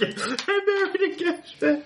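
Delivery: high slow mock wail; imitating wife voice. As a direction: echo the wife's wail, high. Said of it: "He just said gas bag".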